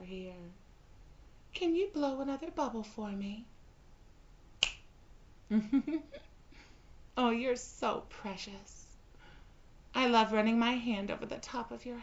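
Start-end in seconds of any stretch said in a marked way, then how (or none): 5.5-6.2 short teasing giggle; amused giggle. Acted. Bride giggling at her pet, teasing. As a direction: laugh down at him, amused.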